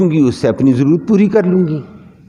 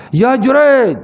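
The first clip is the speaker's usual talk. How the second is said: mother calls her son's name, pitched high from outside; mother calling out; 10 semitones higher, 3 dB louder, much slower than usual. Retold or imitated. Mother calls Juraij from outside the mosque, raised call. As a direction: call him through the mosque door, raised and a little louder. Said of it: "ماں نے باہر سے کہا".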